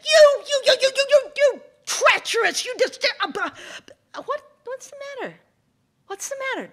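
shrieking very high and loud, stammering; trapped snake screeching at the fox; indignant snake. Acted. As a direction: sputter in outrage.

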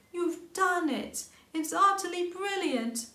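high, loud, slow cry of delight; delighted exclaim. A narrator's reading. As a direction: praise him to the skies. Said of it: "Mole exclaimed".

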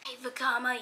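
normal talking voice

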